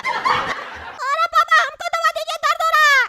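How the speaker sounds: put-on high-pitched whining child voice; squeaky kid complaining of a bellyache; high, plaintive cartoon child; little boy in pain. Child in pain begs for medicine.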